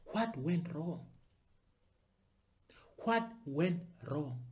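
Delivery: slow and soft, a grave question; quiet rhetorical question. Real, not acted. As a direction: ask it slowly, low and hushed.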